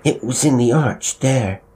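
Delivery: put-on high, loud scared child; frightened cry. A narrator's reading. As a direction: insist in alarm. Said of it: "Bill cried".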